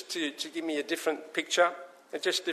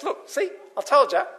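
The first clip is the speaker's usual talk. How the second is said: high, put-on telling-tales voice; tattling brother; 9 semitones higher, 4 dB louder than usual. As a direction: act the brother reporting to Dad, pitched up. Brother tattles to father about prodigal.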